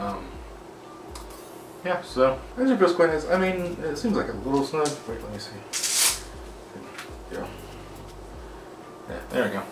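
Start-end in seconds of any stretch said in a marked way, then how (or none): none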